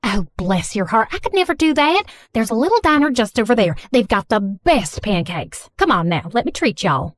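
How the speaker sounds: hospitable Southern mom; thick Southern accent. Acted. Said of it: "speaking in a thick Southern accent".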